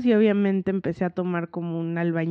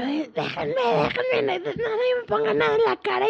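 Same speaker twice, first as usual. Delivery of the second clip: agudo, chillón de pánico, entre risas; voz aguda de pánico; 12 semitones higher than usual. Retold or imitated. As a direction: imita su propio pánico con voz muy aguda. Recrea su pánico al maquillarse. (voz aguda y desesperada de pánico)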